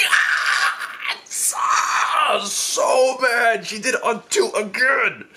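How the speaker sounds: high, strained outraged cry; pained, furious acted wail; mad. Victim tricked on two again, high angry cry. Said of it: "I was so mad".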